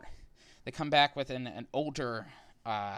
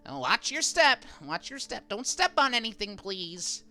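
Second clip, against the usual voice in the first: high-pitched little creatures fussing; small creatures' warning; 9 semitones higher, 3 dB louder than usual. Acted. Tiny Alliamites telling everyone to watch their step, high warning voice. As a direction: pipe up anxiously in a high little voice. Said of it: "telling everyone in Sylvan, like".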